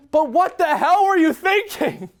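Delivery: high, mock-furious yell; hammed-up, high-pitched scolding; exasperated outburst. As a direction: berate the coach in comic outrage.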